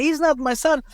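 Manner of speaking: high-pitched retold protest; insistent denial. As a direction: protest it as the father, pitch lifted. Father repeats his denial.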